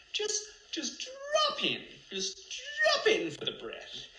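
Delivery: very high, drawn-out parody guru voice; exaggerated high-pitched breathing instruction; mock meditation. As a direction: stretch out each "drop" in a silly squeal.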